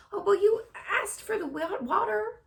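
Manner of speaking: very high meek hired-hand voice; meekly. Acted. Hired hand excuses himself to the soaked farmer, meek. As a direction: stammer it out meekly. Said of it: "said Lurvy meekly".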